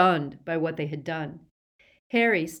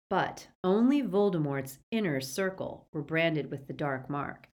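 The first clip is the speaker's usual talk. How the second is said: plain reading voice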